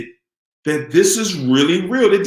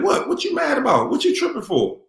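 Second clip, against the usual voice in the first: mock innocent; 8 semitones higher than usual. Voicing the narc's fake innocence.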